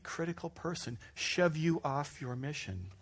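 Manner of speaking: ordinary delivery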